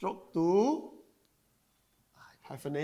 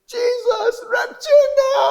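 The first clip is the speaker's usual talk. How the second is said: very high, loud, drawn-out mock wail; crying daughter; 12 dB louder, slower than usual. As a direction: cry out in comic desperation to heaven. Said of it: "She's crying". (ratapan pura-pura bernada tinggi)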